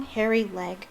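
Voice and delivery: usual speaking voice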